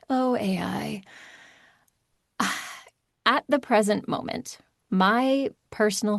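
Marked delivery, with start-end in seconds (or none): 0.1-3.3 resigned sigh. Real, not acted. Dreading the topic of AI.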